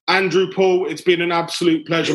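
usual speaking voice

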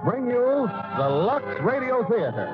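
ordinary talk

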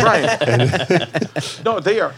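can't stop laughing while agreeing; laughing at the roofer joke; laughing reply. Real, not acted.

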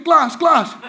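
high, loud calls of his name; urgent calling. Retold or imitated. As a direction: shout to wake him. Slapping the man again, calling.

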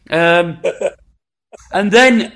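ordinary talk, nothing marked

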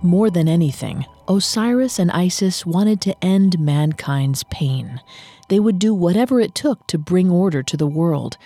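ordinary talk, nothing marked

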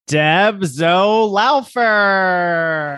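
slow, drawn-out winner reveal; winner announcement. Acted. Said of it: "And the winner is?".

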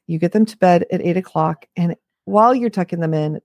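normal talking voice

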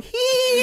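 very high eerie titter; creepy giggle. Pretend. Imagining Hoops in the dark corner, very high creepy titter.

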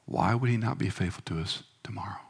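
soft tender words ending in a whisper; tender reassurance. Retold or imitated. Wife gently reassuring her suffering husband, soft and tender. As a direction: quietly reassure a husband in pain. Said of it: "she looked at me and she said".

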